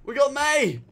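high, urgent yell turning to victory; triumphant cheer. Real, not acted. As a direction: cheer the kill at a high pitch. Gets a kill in game.

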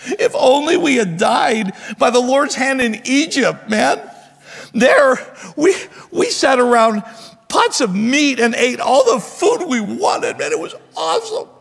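high-pitched, hammed-up wailing grumble; whining complaint. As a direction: moan theatrically about what was lost. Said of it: "I kinda have to put tone to it".

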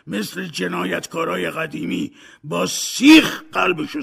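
angry shout. A narrator's reading. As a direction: yell him down with contempt. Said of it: "اسکروچ فریاد زد".

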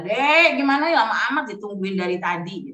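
ordinary talk

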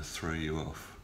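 normal talking voice

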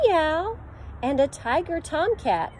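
ordinary delivery

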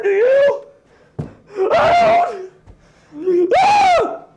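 comic existential freak-out; rising meltdown. Acted. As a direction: pitch climbing into a shriek. Spirals into despair about life.

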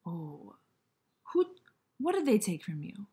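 ordinary talk, nothing marked

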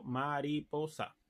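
slow, measured "ma-ri-po-sa"; deliberate, even four-beat word; four-syllable rhythm word. Shown as a demonstration. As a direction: space out "mariposa" syllable by syllable. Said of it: "de cuatro sílabas".

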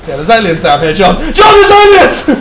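shouting the warning, loud and high; panicked loud call about the aliens; alarmed shouting. Real, not acted. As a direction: yell it out to warn your partner. Alarmed calling to teammate.

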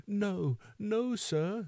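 put-on high, pleading little-boy voice; sobbing. A narrator's reading. Orphan boy begging the beadle, acted sobbing. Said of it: "sobbed Oliver".

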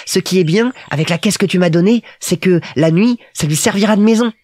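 put-on high little-boy voice, thinking aloud; musing Petit Prince. A narrator's reading. Little prince sizes up the pilot's plane, high and musing. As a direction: reason it out like a small child.

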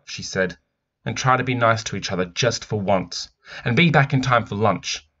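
animated picture book narration; story reading. A narrator's reading. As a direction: read it out like a storyteller. Reads the picture book aloud.

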